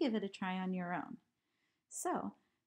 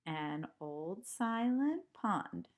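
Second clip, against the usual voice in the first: slow recited line; slower than usual. Shown as a demonstration. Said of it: "One, two".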